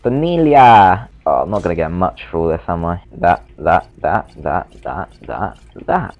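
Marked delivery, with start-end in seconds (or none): 0.0-1.1 drawn-out call. Pretend. Calling out a name.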